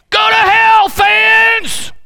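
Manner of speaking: high, full-throated shout; crowd yell. Acted. Fan to rival team at the game, full-throated yell. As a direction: yell it like a rabid football fan. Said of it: "That's what we yell".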